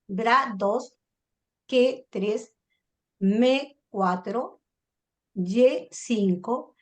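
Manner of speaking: slow, syllable by syllable with pauses; measured tally, each syllable drawn out before its number; counting syllables. Shown as a demonstration. Teacher repeating the verse count for students, syllable by syllable.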